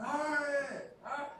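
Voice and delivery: high mock yell of a soldier in pain; retold yell. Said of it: "he's yelling something".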